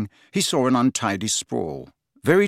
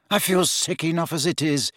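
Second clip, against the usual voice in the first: higher, queasy complaint; begging; 6 semitones higher than usual. A narrator's reading. Ron begs Hermione to stop, pleading. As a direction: plead for some mercy. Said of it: "Ron begged her".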